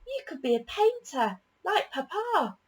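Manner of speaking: chirpy little daughter; little girl. A narrator's reading. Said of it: "said a little girl".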